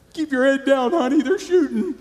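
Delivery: joke-panic to his wife, cracking up; buddy's warning spoofed with laughter; laughing mock alarm. Mock quote while laughing.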